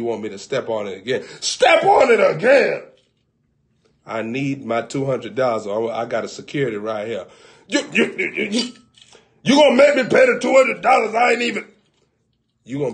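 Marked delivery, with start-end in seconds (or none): 1.4-2.8 loud, high and slow disbelief; outraged shout. Acted. Customer shouting back at the chiropractor, outraged. 7.7-11.7 sputtering outrage. Acted. Customer sputtering at chiropractor.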